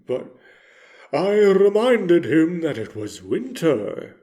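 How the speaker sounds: plain reading voice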